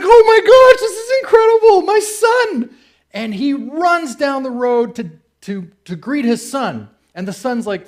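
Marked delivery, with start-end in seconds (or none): 0.0-2.8 much higher and louder, bursting with joy; a father losing it at the sight of his lost son; overjoyed father. Retold or imitated. Father spotting his lost son on the road, overjoyed and shouting with joy. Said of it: "And he loses it".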